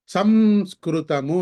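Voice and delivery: సంస్కృతము slow with a closed m; teacher confirming the reading; model word. Shown as a demonstration. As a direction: repeat సంస్కృతము deliberately. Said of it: "అని పలకాలి దాన్ని".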